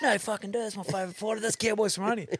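high-pitched, incredulous fan; mock disbelief. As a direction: act out a mate who flatly refuses to believe it, pitch way up. Disbelieving fan friend, acted quarrel.

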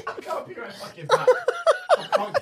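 high-pitched laughing, words squeezed out; cracking up mid-sentence. Real, not acted. Laughing too hard to speak.

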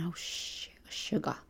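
hushed muttered panic; a quiet private oh no; panicked inner voice. Retold or imitated. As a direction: think it in a soft, horrified hush. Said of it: "I'm thinking".

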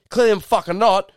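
acted-out indignant anger; imitated angry patron. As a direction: snap back like a fuming punter. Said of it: "he was even walking angry".

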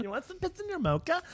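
very high, soft, quick little barista voice; over-enthusiastic barista. Acted. Barista offering a customer something in their mocha, over-enthusiastic. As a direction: offer it eagerly in a tiny high voice. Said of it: "this was an over-enthusiastic barista".

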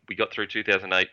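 usual speaking voice

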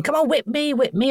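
high, urgent pestering; goading women. Retold or imitated. Voices women goading the whippers.

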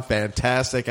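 ordinary talk

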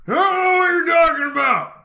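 high, fast put-on yell of a guilty thief; defensive thief shout. Shoplifter yelling back at the store clerk, high mock shout. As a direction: blurt out the denial in a shrill put-on voice.

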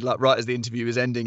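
ordinary delivery

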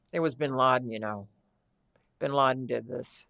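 low, cool impression of a friend; deadpan, low-voiced pronouncement; Kristen Johnson. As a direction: say it low and unbothered. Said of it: "she has that low voice".

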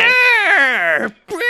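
very high, loud whiny screech; terrible whiny cartoon-creature cry; Bonsly impression. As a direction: screech it like a South Park character. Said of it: "it sounds like a South Park character".

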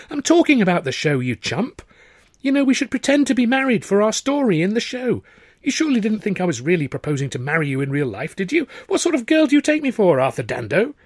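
high, loud mock indignation; teasing Tilly. A narrator's reading. Tilly to Arthur laughing off the proposal, teasing and loud. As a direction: scold him playfully.

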